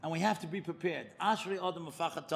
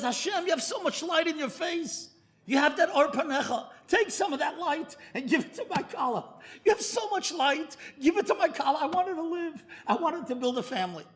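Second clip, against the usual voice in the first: a touch of tears, higher pleading prayer; tearful plea; 8 semitones higher, 3 dB louder than usual. Retold or imitated. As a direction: plead with God for his bride, close to tears. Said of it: "And he's crying".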